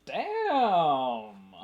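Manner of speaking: shouted damn; loud exclamation. Real, not acted. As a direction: blurt it out loud. Reaction to the vow of silence.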